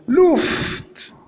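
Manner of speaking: high-pitched "louft" with a long f and crisp t; demonstrated pronunciation. Shown as a demonstration. Teacher giving an example word for U, demonstrated pronunciation. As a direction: model "louft" high, lingering on the f.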